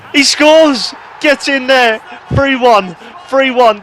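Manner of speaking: high, loud, yelling the goal out; excited goal shout. Real, not acted. Player yelling at his own screen as his striker scores. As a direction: erupt as the ball hits the net.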